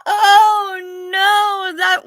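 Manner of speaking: high, loud laughing cry of glee; a real burst of amused disbelief; delighted outcry. Real, not acted. Delighted by a funny chat comment.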